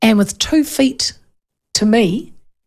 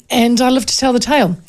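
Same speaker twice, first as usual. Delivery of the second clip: ordinary talk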